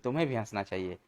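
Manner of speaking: ordinary delivery, nothing marked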